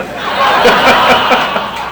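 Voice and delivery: airy, helpless laughter; real laugh. Real, not acted. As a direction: laugh at being caught out. Laugh after the prank is revealed.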